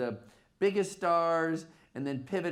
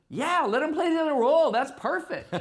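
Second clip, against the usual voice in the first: much higher and louder, gushing approval; eager yes; 10 semitones higher, 6 dB louder than usual. Retold or imitated. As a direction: burst out with enthusiastic agreement. Retold Steve agreeing eagerly.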